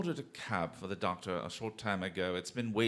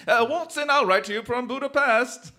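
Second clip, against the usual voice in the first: triumphant call; 13 semitones higher, 13 dB louder than usual. Acted. Disguised Holmes calls out triumphantly.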